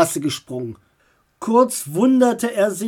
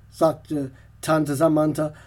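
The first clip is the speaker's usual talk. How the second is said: usual speaking voice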